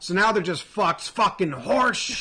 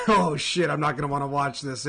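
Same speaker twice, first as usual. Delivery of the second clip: ordinary talk, nothing marked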